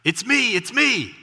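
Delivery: high, loud call from below; yelling back. Retold or imitated. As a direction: holler up cheerfully. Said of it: "he yells back".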